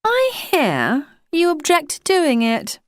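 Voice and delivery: put-on a posh English official addressing a postman, in a high public schoolboy accent; high-pitched public schoolboy accent. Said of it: "in his high-pitched public schoolboyish accent".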